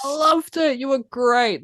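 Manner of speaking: high, gushing fake compliment; hammed-up mock delight; bullshitting praise. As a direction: overdo the enthusiasm insincerely. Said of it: "bullshitting away after you see a show".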